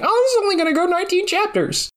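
high, fast mock optimism; naive cheerful voice. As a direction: chirp it like a clueless newbie. Imagined author's naive thought.

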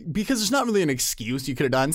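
ordinary talk

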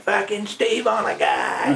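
slow, high mock Maine yell, laughing; Maine catchphrase. Mimicking blueberry crew catchphrases, slow thick Maine accent. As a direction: bark it like a rowdy Maine raker. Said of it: "catchphrases ... people I grew up with".

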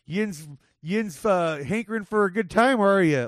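broad Pennsylvania Yinzer accent. Shown as a demonstration. Man imagining his dad's hometown speech, Yinzer accent. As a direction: lift it into a mock Yinzer twang. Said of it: "the Yinzer accent".

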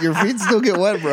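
loud, high-pitched mock exasperation; incredulous protest. Friend arguing about water shoes, incredulous protest.

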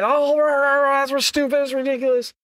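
high mock rant of an angry seller. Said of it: "And they might get mad".

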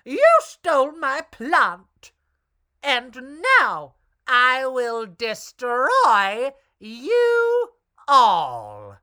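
high, loud, slow witch voice; menacing witch threat; wicked witch. A narrator's reading.

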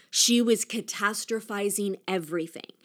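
usual speaking voice

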